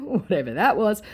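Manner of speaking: normal talking voice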